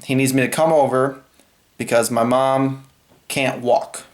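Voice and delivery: normal talking voice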